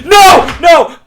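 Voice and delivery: sudden high shouted no at the book; shouting no. Retold or imitated. Reader shouting at a plot twist in shock, loud. Reacting to a twist while reading.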